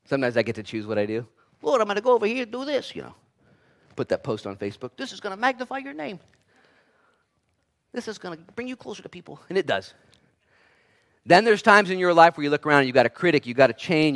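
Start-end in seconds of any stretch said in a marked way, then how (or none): 1.6-2.9 high-pitched put-on voice; eager believer. Acts out choosing what to do. 5.0-9.4 high, soft put-on voice; mock someone pleased with their post, high and hushed; small, high mock voice; self-pleased poster. Acts out posting on Facebook.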